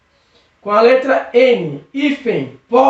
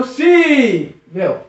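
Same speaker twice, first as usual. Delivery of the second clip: "Sível" with a long held SÍ; stressed syllable stretched. Shown as a demonstration. Teacher checking students noticed the stress, "Sível" with lengthened SÍ. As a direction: draw out the start of "Sível". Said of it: "a sílaba tônica é o sível".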